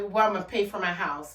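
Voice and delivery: ordinary talk, nothing marked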